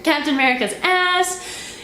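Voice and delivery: ordinary delivery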